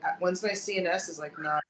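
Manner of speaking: ordinary delivery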